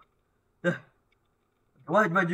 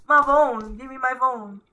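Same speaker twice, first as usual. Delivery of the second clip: high, raised-voice demand; insistent high cry; urgent demand; 7 semitones higher, 4 dB louder than usual. Real, not acted. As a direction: call out high for it to be handed over. Demanding the missing phone back.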